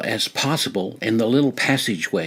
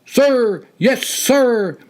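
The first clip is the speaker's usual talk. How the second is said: high, loud recruit reply; shouted back; 13 semitones higher, 5 dB louder, much slower than usual. A narrator's reading. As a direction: bark it back at attention. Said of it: "the red-faced candidate shouted back".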